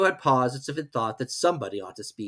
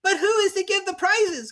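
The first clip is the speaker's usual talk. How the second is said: very high chorus voice; chorus asking; 4 dB louder than usual. Acted. Animals asking the Dodo, very high. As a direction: ask all together. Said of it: "quite a chorus of voices asked".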